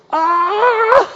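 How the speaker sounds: sudden high shriek of fright; startled shriek. Retold or imitated. Preacher mimics someone spotting a bug in the laundry, shrieking. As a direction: shriek like you just saw a bug.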